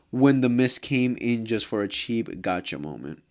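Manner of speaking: ordinary talk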